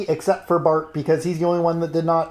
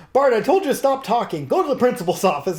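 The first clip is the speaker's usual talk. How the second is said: mock stern teacher; 7 semitones higher, faster than usual. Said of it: "she says".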